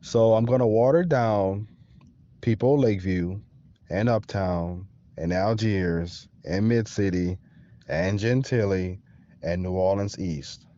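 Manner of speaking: ordinary delivery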